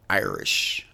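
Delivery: low, deep attempt at an Irish accent. Shown as a demonstration. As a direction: try a quick Irish accent on one word. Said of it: "I was trying to do the accent".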